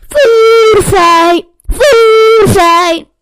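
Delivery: very loud high screaming cry. A narrator's reading. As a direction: scream it with all you have. Retake, much louder.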